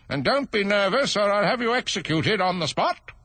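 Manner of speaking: high, threatening royal warning; pompous threatening king. Acted.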